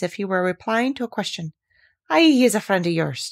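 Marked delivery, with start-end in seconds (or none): none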